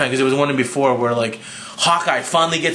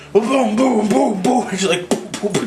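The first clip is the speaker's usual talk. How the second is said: mock smash sounds; 7 semitones higher than usual. Hulk stomping, retold.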